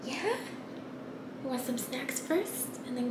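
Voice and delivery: whispering beside a sleeping sibling; hushed, breathy questions; soft unvoiced whisper. Real, not acted. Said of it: "Thomas is sleeping still".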